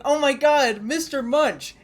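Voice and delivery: put-on high, loud, squealing kid voice; excited little kid. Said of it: "Imagine being a little kid going".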